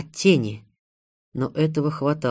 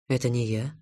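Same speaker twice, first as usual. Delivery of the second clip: ordinary delivery, nothing marked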